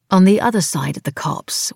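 usual speaking voice

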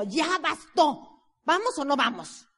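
mock exasperation; impatient push. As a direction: lose patience with a stalling friend. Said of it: "le decía yo".

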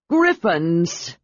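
sharp dragon warning, in a voice unlike the narrator's; dragon bellow. A narrator's reading. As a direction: call it out as a dragon spotting griffins. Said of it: "Sky bellowed".

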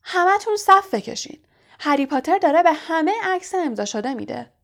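high, loud jeering announcement; shouting to a crowd. A narrator's reading. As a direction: yell mockingly for everyone to hear. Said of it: "رو به جمعیت کرد و فریاد زد". (با فریاد رو به جمعیت)

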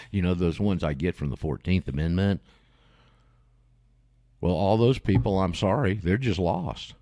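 ordinary delivery

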